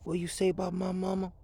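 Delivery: high, strange put-on voice, menacing question; offended challenge. Young man confronting the clerk about his mother, menacing. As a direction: play the movie tough guy taking offense. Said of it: "and he goes".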